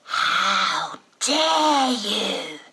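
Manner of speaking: very slow, loud, strained creature shriek; Gollum voice. Retold or imitated. Six-year-old throwing mum's words back as a shrieking Gollum. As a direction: stretch it into a crying Gollum howl. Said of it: "out of his innocent little face comes Gollum".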